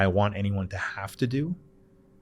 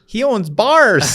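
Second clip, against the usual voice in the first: much higher, louder, slow mock child voice; daughter's blunt answer; 13 semitones higher, 12 dB louder, much slower than usual. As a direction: imitate his daughter announcing it proudly and slowly. Daughter answers other parents.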